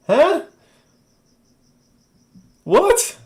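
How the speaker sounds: very high-pitched squeak of confusion; baffled. Real, not acted. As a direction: react in high, puzzled disbelief. Baffled by viewer's explanation.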